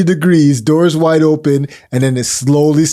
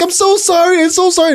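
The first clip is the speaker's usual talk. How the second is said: high, frantic apologizing voice; mock apology; 13 semitones higher than usual. Woman caught on the toilet, apologizing in a high panic. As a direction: squeak out a flustered apology. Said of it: "And she's like".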